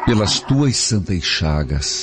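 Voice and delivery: prayer. Acted. Priest leads a repeated prayer.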